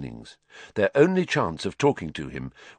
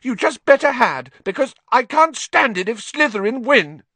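much higher, louder, urgent demand; excited girl insisting on a win; shrill; 5 dB louder than usual. A narrator's reading. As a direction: press him, voice climbing. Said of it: "Hermione said shrilly".

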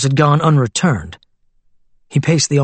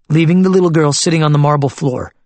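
plain reading voice

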